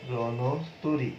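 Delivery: very slow রণতরী with the ণ said as ণো; pronouncing the o sound. Shown as a demonstration. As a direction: draw out রণতরী, ণ with an o. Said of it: "যখন তুমি বলবে". (খুব ধীরে রণতরী, ণ-কে ণো বলে)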